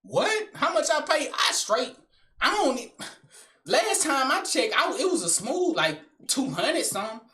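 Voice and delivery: put-on high-pitched, defensive girl voice; hammed-up female reply; imitated girl. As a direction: mimic a girl justifying herself, pitch up. Girl answers about hair price.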